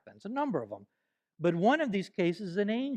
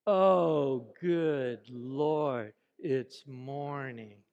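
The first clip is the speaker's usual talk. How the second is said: slow, low, dragging despair; hammed-up gloomy wake-up; depressed; 5 semitones lower, much slower than usual. Shown as a demonstration. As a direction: groan it out like the day is a burden. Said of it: "Let's hear your best depression".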